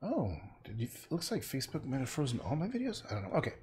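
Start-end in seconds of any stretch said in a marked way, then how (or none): none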